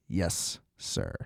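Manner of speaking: very soft, low, docile reply; hushed deadpan obedience; calm mock compliance. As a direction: imitate a suspect meekly going along. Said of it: "like, a very calm".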